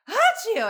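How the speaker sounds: exaggerated sneeze imitation; loud imitated sneeze. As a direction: belt out a fake sneeze. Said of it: "he would just like".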